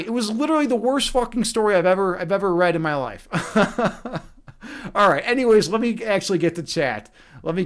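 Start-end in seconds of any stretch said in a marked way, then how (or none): 3.3-4.2 real chuckling laugh. Real, not acted. Storyteller done with the worst story ever, real laugh. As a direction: laugh it off after the punchline.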